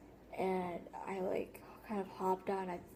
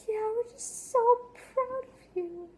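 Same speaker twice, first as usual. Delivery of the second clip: high, loud, gushing parent voice, slowed; mock proud parents; 8 dB louder, slower than usual. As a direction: ham up the doting parents, way up high. Parents praising her, mocked.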